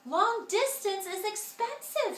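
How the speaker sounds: high, alarmed little sister; alarmed cry. A narrator's reading. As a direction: protest in a shrill panic. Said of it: "Sister cried".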